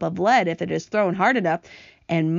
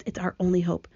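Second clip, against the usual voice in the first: ordinary delivery, nothing marked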